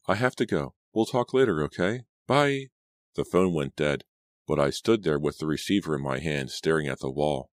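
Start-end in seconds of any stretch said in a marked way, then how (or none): 0.0-2.7 high, hurried goodbye; breathless. A narrator's reading. Laura saying goodbye to Martha, breathless. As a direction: end the call in a flustered, raised pitch. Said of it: "she said breathlessly".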